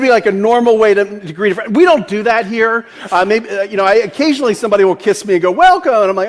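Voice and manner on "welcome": high, louder sing-song greeting; a hammed-up friendly welcome; mock cheery welcome. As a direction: gush it like a greeter at the door. Retelling a greeting with kiss.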